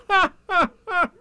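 high, loud, forced ha-ha; careless laugh. A narrator's reading. Rabbit pretending he isn't lost, high careless laugh. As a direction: separate high laughs, made to seem carefree. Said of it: "he gave the careless laugh".

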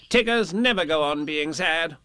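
high, know-it-all assurance; explaining Rabbit. Acted. Rabbit correcting doubtful Piglet, confidently explaining. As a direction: brush off the doubt with certainty. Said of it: "explained Rabbit".

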